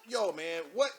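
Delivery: ordinary talk, nothing marked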